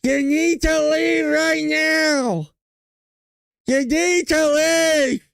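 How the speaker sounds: high, slow, shrill mock shout of the line; Karen Bass impression. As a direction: ham up the mayor ordering them out, high and drawn out. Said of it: "Who talks like that?".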